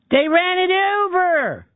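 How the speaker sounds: put-on very high, drawn-out Philly accent; hammed-up Philly caller wail, stretched slowly. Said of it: "hears me doing this accent".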